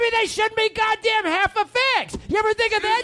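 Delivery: very high, indignant yell; furious. Acted. Bandleader insulting band in quarrel, high furious yell.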